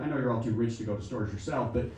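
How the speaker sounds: ordinary talk, nothing marked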